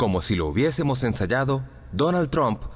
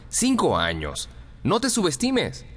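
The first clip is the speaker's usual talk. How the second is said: insist, then lift the pitch high; emphatic urging; 7 semitones higher than usual. A narrator's reading. Bill urging the author not to underestimate himself, emphatic.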